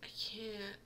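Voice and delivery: breathy whisper, voice gone; whispered I can't in grief; whispered despair. Real, not acted. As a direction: barely get the words out. Overwhelmed by sad scene.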